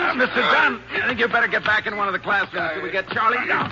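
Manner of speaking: high, loud call across the hall; urgent call. Acted. Ranger tells Mister Dunn to go back inside, calling loudly. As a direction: shout the warning over the tussle. Warns bystander during struggle.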